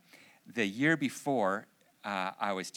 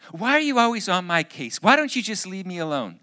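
put-on high, loud teenage yell; exasperated daughter outburst; 10 semitones higher, 14 dB louder than usual. Daughter snaps at mother asking about her day, exasperated outburst. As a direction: imitate a daughter lashing out at her mom. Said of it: "This is how my daughter would talk".

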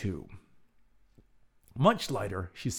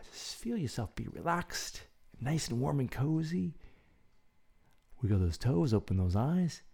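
guided breathing; 3 semitones lower, 5 dB quieter than usual. Acted. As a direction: count the breaths calmly and gently. Leads a breathing exercise.

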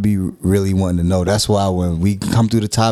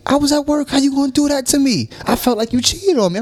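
much higher, hammed-up wounded complaint; mock betrayed self. Husband replaying feeling cheated on over a show, mock wounded high whine. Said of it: "I said".

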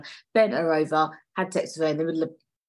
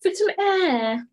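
very high, louder mock-innocent voice; put-on girlfriend voice; 5 dB louder than usual. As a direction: squeak out the excuse as the girlfriend. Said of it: "And she's like".

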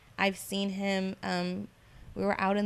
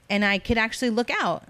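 normal talking voice

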